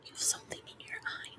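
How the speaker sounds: soft unvoiced whispering close to the mic; ASMR whisper. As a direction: whisper gently as if soothing someone.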